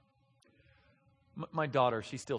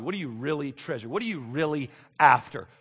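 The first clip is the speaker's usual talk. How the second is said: ordinary delivery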